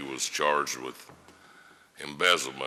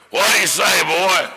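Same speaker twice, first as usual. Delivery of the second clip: much higher, louder mock old-lawyer holler, with a laugh; old lawyer's loud question; 12 semitones higher, 9 dB louder than usual. Old lawyer asking the young man after the verdict, loudly imitated. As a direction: holler it across the courtroom.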